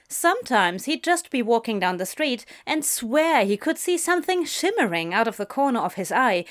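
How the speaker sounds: ordinary delivery, nothing marked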